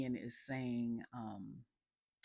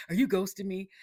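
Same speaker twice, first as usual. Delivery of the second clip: panicky; 6 semitones higher, 9 dB louder, faster than usual. Retold or imitated. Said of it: "Lamar's all panicky".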